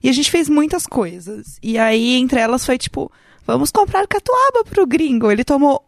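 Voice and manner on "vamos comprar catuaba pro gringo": excited. Retold or imitated. Quoting group plan.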